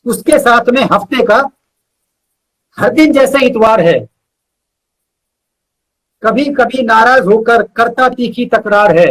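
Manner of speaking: measured, rhythmic recital with pauses between phrases; unhurried poetry reading, each line landing on its end; poem recitation. Acted. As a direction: recite it like a heartfelt ode to your best friend. Recites his friendship poem.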